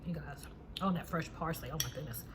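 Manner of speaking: talking through a bite of food; mouth full. Real, not acted. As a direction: speak around a mouthful. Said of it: "Just bit into some of that".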